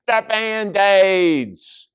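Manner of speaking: high, loud, very drawn-out shout. A narrator's reading. Stink runs up to the painting, shouting high. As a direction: yell it out, stretching the words. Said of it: "And Stink shouted".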